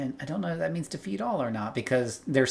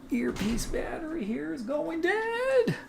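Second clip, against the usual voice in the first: dying robot voice; 4 dB quieter, slower than usual. Pretend. Mock dying earpiece battery.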